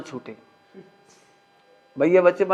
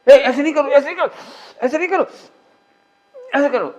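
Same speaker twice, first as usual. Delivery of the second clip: high, loud scolding of a fidgeting man; mock scolding; 7 semitones higher, 4 dB louder, faster than usual. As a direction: snap at him to stop, half in jest. Scolding someone who ate chili. (हल्की नकली डाँट, ऊँची और तेज़ आवाज़ में)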